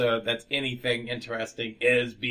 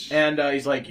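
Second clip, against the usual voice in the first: ordinary delivery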